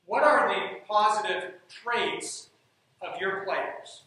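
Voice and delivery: ordinary talk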